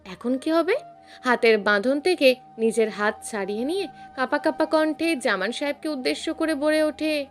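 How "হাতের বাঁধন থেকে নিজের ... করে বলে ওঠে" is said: ordinary delivery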